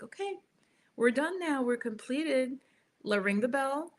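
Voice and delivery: put-on high bright sing-song for kids; cheerful sing-song. Shown as a demonstration. Parent ending yoga time with kids, high sing-song. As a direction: sing it out to close the session. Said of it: "you're like".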